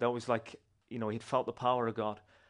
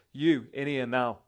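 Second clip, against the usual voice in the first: slow, louder imitated order; retold gruff command; 3 semitones higher, 7 dB louder, slower than usual. Bar owner summoning the preacher, retold command. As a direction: order someone inside, word by word.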